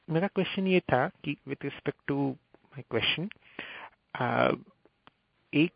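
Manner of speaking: Hindi-English mix, quick start; north indian accent. Real, not acted. Caller mixing Hindi and English.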